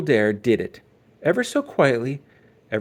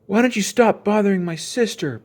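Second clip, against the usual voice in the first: angry; 9 semitones higher, 5 dB louder than usual. A narrator's reading. Brother angrily confronting Too Tall.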